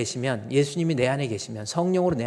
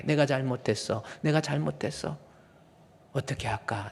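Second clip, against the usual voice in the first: usual speaking voice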